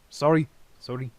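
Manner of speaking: quick, loud double sorry; startled apology. Acted. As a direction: blurt out the apology. Spirit apologizes after burning forehead.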